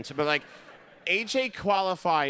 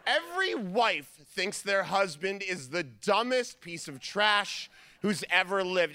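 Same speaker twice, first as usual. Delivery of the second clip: normal talking voice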